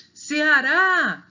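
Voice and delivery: CEARÁ with a long, loud, high RÁ; calling the word. Shown as a demonstration. Teacher demonstrating final stress, calling ceaRÁÁ. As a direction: call out Ceará, lifting and holding RÁ. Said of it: "o rá é a sílaba mais forte".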